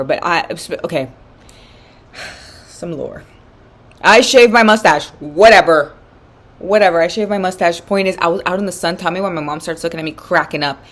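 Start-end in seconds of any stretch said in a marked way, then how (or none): 4.0-5.9 loud, drawn-out confession; loud admission. Real, not acted. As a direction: blurt it out slowly and loudly. Blurted admission, then casual repeat.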